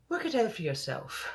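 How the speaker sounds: lightly put-on English accent, queenly; a hint of a royal English accent; Queen's English accent. The Queen answers the confused general, lightly English-accented. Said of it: "I have to do the Queen's voice".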